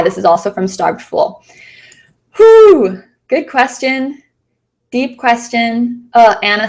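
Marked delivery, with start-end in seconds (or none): 2.4-3.0 high loud whew; impressed whew. Real, not acted. Reacting to a deep question.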